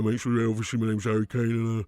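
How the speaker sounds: a lightly nasal footballer's voice, speaking through the nose; a hint of a nasal, droning player interview; Harry Kane nasal. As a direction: talk it all down the nose like a bored striker. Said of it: "let me just try and speak through my nose here".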